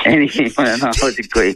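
high voice breaking with real laughter; laughing. Real, not acted. Host moving on after the guest's joke, laughing. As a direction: try to carry on through the giggles.

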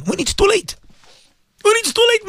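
pitched high, building to a cry on too late; preacher's rising climax; rising warning. Real, not acted.

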